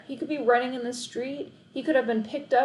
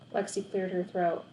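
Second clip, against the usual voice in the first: ordinary delivery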